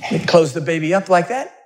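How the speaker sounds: ordinary talk, nothing marked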